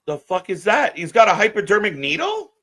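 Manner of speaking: can't believe what he's seeing; shocked outburst. Real, not acted. Watching a needle go through a wrestler's cheek, shocked.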